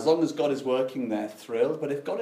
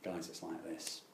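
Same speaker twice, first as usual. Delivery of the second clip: much softer, lower, slow and embarrassed; sheepish confession; 8 semitones lower, 14 dB quieter, slower than usual. Retold or imitated. Jonah sheepishly telling friends, much softer and lower. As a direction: mumble it like an awkward admission. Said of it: "And he'd say".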